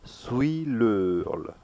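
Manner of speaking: "leul" with a closed vowel, drawn out. Shown as a demonstration. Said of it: "this combination is closed".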